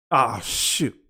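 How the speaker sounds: ordinary talk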